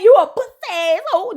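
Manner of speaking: high, whiny mock tantrum; hammed-up childish snarl; mock insult. Trick insulting Joy in a high, whiny mock tantrum.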